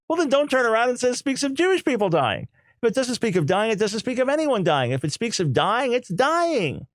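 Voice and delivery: high-pitched, insistent retold argument; argumentative rebuttal. Lecturer arguing back at the rabbis, insistent and high-pitched.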